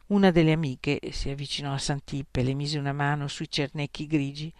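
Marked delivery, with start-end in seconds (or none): none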